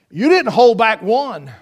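ordinary talk

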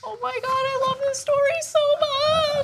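high, squealed adoration; gushing squeal. Real, not acted. Gushing over the dog story.

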